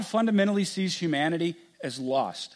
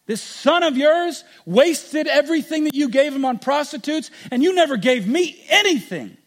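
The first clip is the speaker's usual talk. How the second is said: higher, louder bitter complaint; elder son accusing father, furious; angry son; 8 semitones higher, 6 dB louder than usual. Acted. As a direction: throw every grievance at the father. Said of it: "He's angry".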